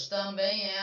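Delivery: ordinary talk, nothing marked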